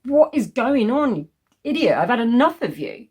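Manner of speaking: angry yell. Acted. Grabbing him by the arm in the shop, a furious yell. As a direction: yell at him, fed up, dragging the words.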